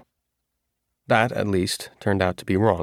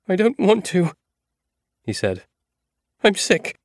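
much higher, pleading whine; whining prisoner; 11 semitones higher than usual. A narrator's reading. Hatcha refusing to leave cell, high pleading whine. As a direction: beg to stay like a scared man.

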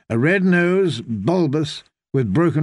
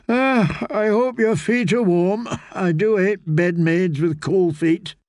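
a sleepy yawn breaking into higher words; 6 semitones higher than usual. A narrator's reading. Drowsy lady to Elaine at bedtime, mid-yawn. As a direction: talk through the end of a yawn. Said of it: "She yawned again".

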